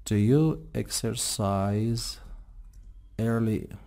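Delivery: exercise stretched very slowly; slow teaching demo. Shown as a demonstration. Teacher modelling the question for students, very slow. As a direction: drag each syllable of exercise.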